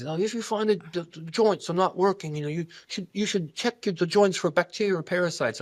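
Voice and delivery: an English doctor giving advice, lightly imitated English accent. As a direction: quote the mentor in a mild put-on English accent. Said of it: "this little English accent".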